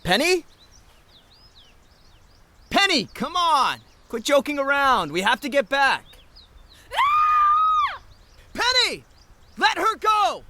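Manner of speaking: high, loud panicked calls; frantic calling. Acted. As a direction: call out desperately into the woods. Searching for girlfriend, then she is seized.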